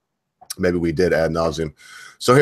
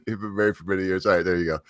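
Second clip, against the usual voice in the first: ordinary talk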